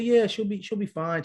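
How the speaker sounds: high-pitched imitation of a breezy doctor; dismissive reassurance. Doctor answering worried husband, dismissive. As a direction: wave the concern away in a lifted voice.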